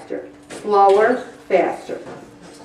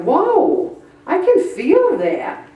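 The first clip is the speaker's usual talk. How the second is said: high, drawn-out mock amazement; acted amazement; 7 semitones higher, slower than usual. Exerciser feeling yesterday's weights, amazed. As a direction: stretch it out as a surprised discovery. Reacts to next-day sore muscles.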